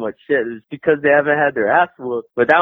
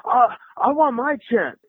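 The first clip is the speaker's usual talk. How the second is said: insistent fighter; 12 semitones higher than usual. Retold or imitated. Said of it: "He's like".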